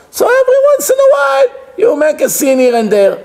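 high, persuasive squeal of an excuse; high-pitched excusing. Acted. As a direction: voice leaps high, quick at first. Acted permissive inner voice excusing sin.

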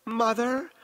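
put-on high, childlike appeal; pleading little girl. A narrator's reading. Possessed woman to her mother, pleading little girl. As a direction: call for mother like a small child. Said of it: "pleaded in her little girl tones".

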